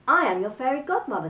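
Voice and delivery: fairy introducing herself to Gertie; fairy godmother. Acted. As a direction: announce yourself sweetly. Fairy appears.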